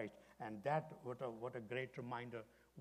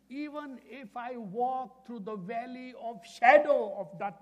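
much higher and louder, slow and proclaiming; wholehearted recitation; 11 semitones higher, 16 dB louder, slower than usual. Shown as a demonstration. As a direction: proclaim the verse to the whole hall. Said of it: "like you really mean it".

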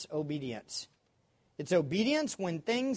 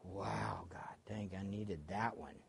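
whispering; 9 semitones lower, 12 dB quieter, slower than usual. Retold or imitated. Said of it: "and I'm like".

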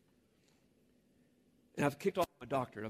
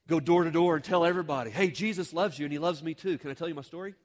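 loud, eager door-to-door pitch; hammed-up zeal, calling out to strangers; overeager street evangelist; 3 semitones higher, 11 dB louder than usual. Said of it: "Okay, maybe not quite like that".

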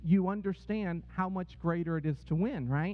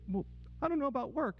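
higher, softly hurried distress; retold tearful caller; 7 semitones higher, 4 dB quieter, faster than usual. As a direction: imitate an upset employee, quick and high. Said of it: "He's crying".

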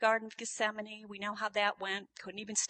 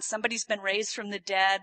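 ordinary delivery

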